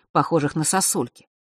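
usual speaking voice

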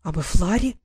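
a mild gasped question rising; understated astonished question; gasping in surprise. A narrator's reading. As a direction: gasp it out, lightly surprised. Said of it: "ахнула Василиса".

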